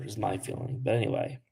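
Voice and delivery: ordinary talk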